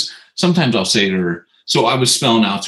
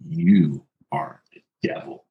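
low, slow accusing voice in the background; accusing inner voice; 5 semitones lower, 5 dB quieter, slower than usual. Retold or imitated. Dad's guilty conscience condemning him, low and slow. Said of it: "part of me hears in the background".

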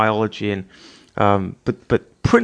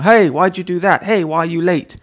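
higher, louder accusing questions; reproachful; 5 semitones higher, 4 dB louder than usual. Retold or imitated. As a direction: call out the spouse for being late. Confronting spouse over mistakes.

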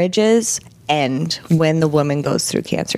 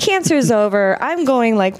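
ordinary talk, nothing marked

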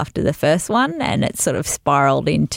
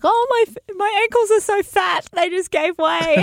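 much higher squeaky mock voice; embarrassed friend. Imitating the embarrassed model blaming her ankles, high squeaky voice. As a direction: play the flustered friend laughing off the fall.

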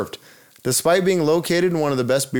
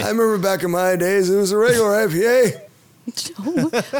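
put-on creaky old man, back in my day; 8 semitones higher than usual. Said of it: "you do that old man voice too good".

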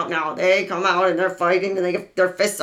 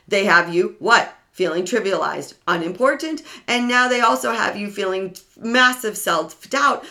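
ordinary delivery

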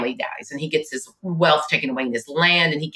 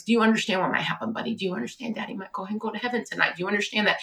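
soft, hurried, slightly raised pitch; gentle urgent questioning; 3 semitones higher, 7 dB quieter, faster than usual. Retold or imitated. Mother gently preparing her son for his father's death, hushed urgency. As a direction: speak softly and quickly, pleading for understanding.

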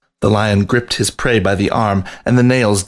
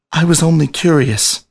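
higher, defensive protest; arguing; 7 semitones higher than usual. A narrator's reading. Rat defending himself to the lion, higher defensive argument. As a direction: insist on it defensively. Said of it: "he argued".

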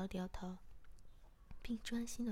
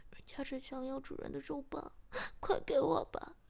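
higher-pitched coy pleading; frowning plea; 3 semitones higher, 5 dB louder than usual. A narrator's reading. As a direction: beg with a slight frown. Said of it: "皱起眉头说". (皱眉撒娇央求)

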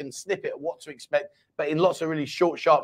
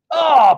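loud, drawn-out "ah!"; loud exclamation; 9 semitones higher, 12 dB louder than usual. Real, not acted. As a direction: cry out at the surprising answer. Reacts to Paul saying no.

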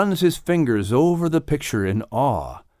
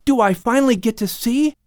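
put-on high, eager child's voice, begging; a boy who can't wait any longer; young Lamech pleading with Adam, high and excited; 5 dB louder than usual. A narrator's reading. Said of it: "Lamech keeps pleading".

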